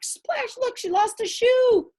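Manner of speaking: usual speaking voice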